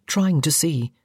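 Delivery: ordinary delivery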